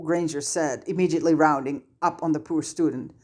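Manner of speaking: ordinary delivery, nothing marked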